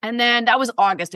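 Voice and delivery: ordinary talk, nothing marked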